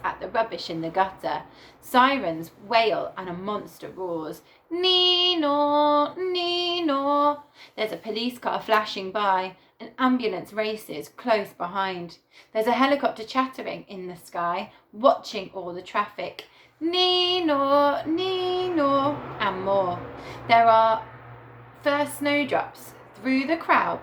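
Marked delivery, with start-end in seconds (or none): 4.7-7.4 high, loud sung nee-naw siren; siren imitation. A narrator's reading. Reading the city book to children, imitating a wailing police siren. 16.8-19.2 siren imitation. A narrator's reading. Reading the city book to children, imitating a wailing police siren.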